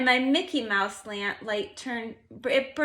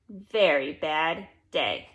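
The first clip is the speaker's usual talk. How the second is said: ordinary delivery, nothing marked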